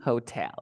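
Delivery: "Hotel" as ho-TEL, falling; stress on TEL. Shown as a demonstration. Teacher repeating after student, final-syllable stress. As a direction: put the voice's force on tel in "Hotel". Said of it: "¿dónde va la fuerza de voz?".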